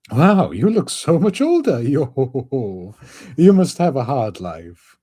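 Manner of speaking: loud high goofy voice; high excited skeleton voice. Acted. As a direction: exclaim in a high silly voice. Brook voice with laugh.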